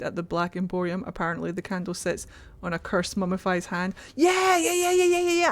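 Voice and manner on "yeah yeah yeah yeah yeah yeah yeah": excited yeah yeah. Real, not acted. Streamer eagerly agrees with chat, high excited yeahs.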